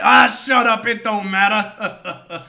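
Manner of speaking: loud, high cut-in, then drawn out; relentless mock scolding. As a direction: shout the kid down, imitating. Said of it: "being fucking relentless with the kid".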